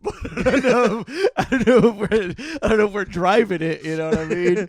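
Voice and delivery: loud, high, through laughter; cracking up while explaining; laughing hard. Real, not acted. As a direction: talk through a real laughing fit. Cracking up at own joke.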